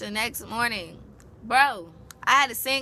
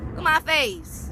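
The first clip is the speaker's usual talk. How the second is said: normal talking voice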